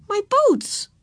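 put-on high, small child's voice; upset little girl. A narrator's reading. Girl fretting instead of saying thanks, plaintive. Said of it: "said Ramona".

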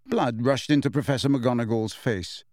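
usual speaking voice

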